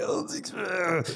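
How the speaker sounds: high, slow mock choke-up, voice catching; choked up. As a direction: act out almost breaking into tears. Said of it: "Nicole might start crying and I'm like".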